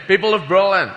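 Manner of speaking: President Kennedy. Acted. President addresses Berlin, accent slipping. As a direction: attempt the speech voice and lose it. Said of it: "I've lost my fucking accent now".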